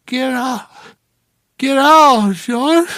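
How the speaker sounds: high, loud, furious cries; angry woman. Acted. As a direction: scream him out of the room. Woman slapping at him.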